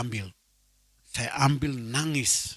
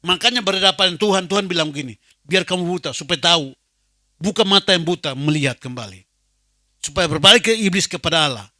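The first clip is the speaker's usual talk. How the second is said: louder scripture proclamation; fervent preaching; 6 dB louder than usual. Real, not acted. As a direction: preach the verse with conviction. (membacakan ayat dengan khotbah lantang)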